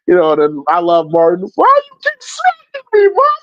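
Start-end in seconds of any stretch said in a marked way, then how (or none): 1.5-3.3 put-on high, whiny child voice; mock kid whine. Acting out a kid being hit.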